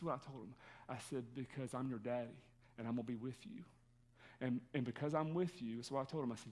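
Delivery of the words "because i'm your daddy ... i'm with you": tender reassurance. Retold or imitated. Father comforting scared son in car.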